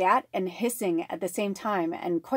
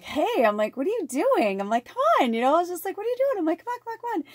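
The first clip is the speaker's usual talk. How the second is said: calling the dog; 11 semitones higher, 3 dB louder, faster than usual. Retold or imitated. Calling dog who stopped in pasture.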